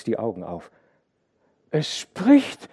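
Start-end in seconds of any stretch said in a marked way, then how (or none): 1.7-2.7 hohe, laute Kinderstimme; ängstliches Kind. A narrator's reading. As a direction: wie ein banges kleines Mädchen. Verängstigtes Kind über das Meer. (kindlich verängstigt)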